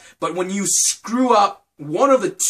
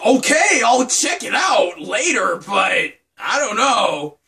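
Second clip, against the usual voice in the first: drawn-out, raised-pitch mock exasperation; reluctant giving in; 4 semitones higher, 3 dB louder, slower than usual. Vlogger yielding to pushy fans, slow exasperated reluctance. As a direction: give in slowly under protest. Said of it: "And I'm like".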